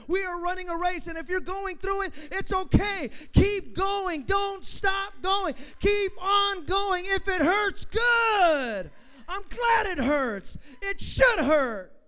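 shouted, high and slow sermon climax; roaring pulpit cry to keep running; full-force preaching. Acted. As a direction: drive it home at the top of the lungs.

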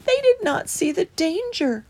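high, understated regret; mildly sad mouse. A narrator's reading. Christopher regretting his family stayed behind, high and mildly sad. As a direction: lament a little in a high voice. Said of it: "said Christopher sadly".

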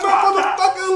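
high-pitched retold protest; mock defiant shout. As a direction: shout back in pretend pain.

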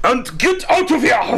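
high, hammed-up Austrian-accented shout; Arnold. Co-host urges the Germans to flee, mock Arnold accent. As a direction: yell it in a bad German action-hero accent. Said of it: "[name], you sounded like Arnold".

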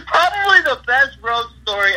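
laughing. Real, not acted. Cracking up after the prank story.

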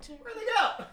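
very high, laughing question; confused guard. Retold or imitated. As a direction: squeak it like a baffled guard. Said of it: "is just like".

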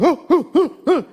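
high, loud, short "oh" cries; startled hoots. Retold or imitated. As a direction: imitate startled little boys. Mimics the startled boys.

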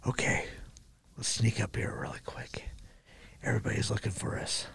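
hushed mock whisper, barely voiced. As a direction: sneak it in a whisper. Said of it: "that part is the stupidest part".

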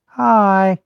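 high, loud alien hello. Pretend. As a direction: greet them like a friendly alien. Voicing an alien greeting.

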